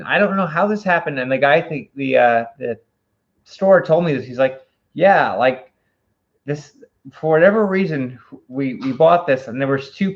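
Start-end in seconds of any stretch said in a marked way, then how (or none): none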